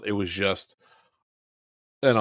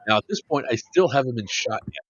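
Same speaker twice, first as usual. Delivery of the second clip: normal talking voice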